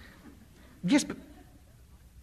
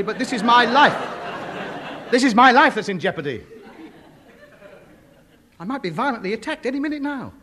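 indignant outburst; 6 semitones higher, 4 dB louder than usual. Acted. Fears for his life, indignant.

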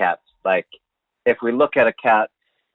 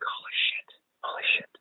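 hushed, breathy holy shit under the breath; whispered panic; 10 dB quieter than usual. Retold or imitated. Two friends whisper in panic over the lost cat. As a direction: whisper it like you'll get caught. Sneaking back into the apartment.

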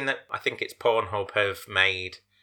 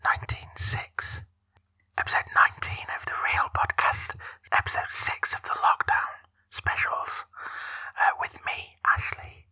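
ASMR whisper. Acted. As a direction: whisper it gently for tingles. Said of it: "using your A S M R voice".

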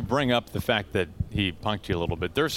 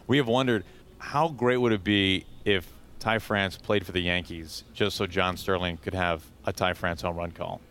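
usual speaking voice

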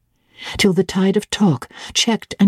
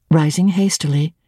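ordinary delivery

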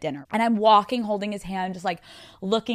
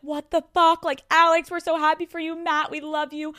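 imitating thrilled parents, higher pitched; shocked and happy; 8 semitones higher than usual. Parents reacting to daughter's engagement, high happy shock. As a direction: exclaim high in happy disbelief. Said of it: "My parents look shocked".

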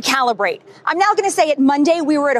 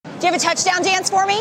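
ordinary talk

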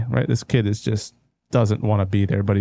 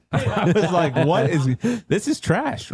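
laughing; 6 semitones higher, slower than usual. Real, not acted. Laughing while quoting himself.

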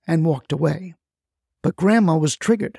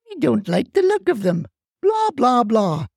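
mocking; 5 semitones higher than usual. Mocking neighbors' excuses.

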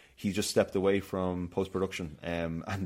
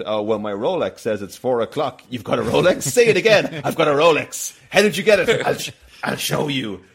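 louder, higher hammed-up brag; mock bragging skit; 4 semitones higher, 9 dB louder than usual. Friends mock a humble-bragging Rolex owner, hammed up. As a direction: brag about the Rolex like it's a secret.

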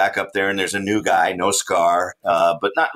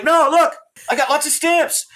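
1 pleading protest; 4 dB louder than usual. Retold or imitated. Arguing at checkpoint with guards.

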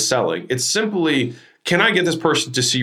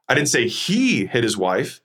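"he" stretched and raised in pitch; stress on HE. Shown as a demonstration. Coach showing emphasis changes meaning, stress on HE. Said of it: "then the emphasis".

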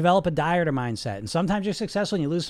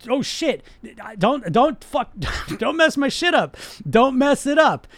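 panicky dieter; 6 semitones higher, 5 dB louder, slower than usual. Retold or imitated. Dieter told to plan ahead.

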